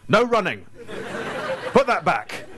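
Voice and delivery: loud, bossy, laughing announcements; mock megaphone shout. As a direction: bark orders like an amplified warden, half laughing. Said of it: "just wander around London going".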